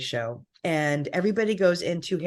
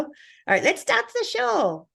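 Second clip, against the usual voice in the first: Heidi Klum impression. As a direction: announce it like the runway host. Heidi runway catchphrase imitation.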